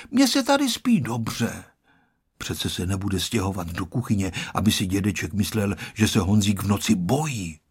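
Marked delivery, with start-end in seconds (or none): 0.1-1.6 put-on high-pitched child's insistence; persistent refusal. A narrator's reading. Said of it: "odmítal vytrvale Honzík". (vytrvale, vyšším dětským hlasem)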